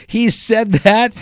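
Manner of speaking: high, loud, drawn-out mock outrage; shocked disbelief. Comedian learning he was blamed for the drugs, incredulous.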